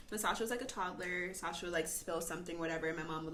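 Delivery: ordinary delivery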